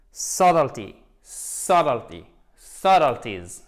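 Subtlety, subtleties: SUT hit hard, the rest falling away; stress on first syllable. Shown as a demonstration. Teacher showing accentuation in the right place, stressed first syllable. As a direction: model Subtlety repeatedly, spaced out, weight on SUT. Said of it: "sur la première syllabe".

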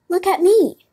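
very high, loud storybook cat; echoing appeal. A narrator's reading. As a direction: chime in, pitched right up. Cat echoes the fox's warning.

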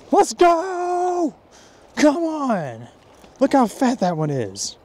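high, drawn-out victory holler, easing down; fired up. Real, not acted. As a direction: celebrate the big catch, high-pitched and real. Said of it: "Can y'all tell I'm fired up?".

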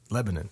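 Lebanon with the ending reduced to -nun; Tennessee pronunciation. Shown as a demonstration. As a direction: local LEB-nun for Lebanon. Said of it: "Except for in Tennessee, we, we say".

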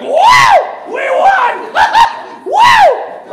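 whooping, high full-volume shout; loud, high celebratory yells; triumphant cheering. Acted. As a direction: holler the victory like a sports parent. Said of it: "I'm the parent that voices that we won".

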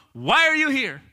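high, loud, insistent demand; pressing question. Retold or imitated. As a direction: push the question at the listener. Question pressed again, right now.

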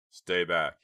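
loud urgent warning. Acted. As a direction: order them back, loudly. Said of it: "he warned the girls".

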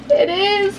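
high, loud squeal of joy; thrilled. Real, not acted. Unboxing her package, high loud thrilled cry. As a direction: burst out, delighted. Opening a package she hoped for.

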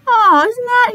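high, touched little gasp of delight; pleasantly surprised aside; delighted surprise. Real, not acted. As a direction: sound charmed by the kind offer. Reacting warmly to the horse.

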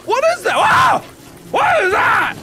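high terrified shout; panicked screaming. Real, not acted. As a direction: shriek it in panic.

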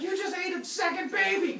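loud high outraged shout. Pretend. Rhea freaks out.

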